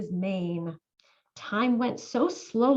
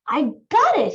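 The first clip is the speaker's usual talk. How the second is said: excited mouse; 3 semitones higher, 7 dB louder than usual. A narrator's reading. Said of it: "he said".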